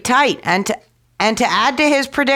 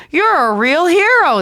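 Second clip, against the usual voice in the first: lightly voiced robbers exclaiming, higher and louder; exclaimed praise; 8 semitones higher, 4 dB louder than usual. A narrator's reading. Robbers hailing Tom Thumb, raised excited praise. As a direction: cheer him a little, higher. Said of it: "they exclaimed".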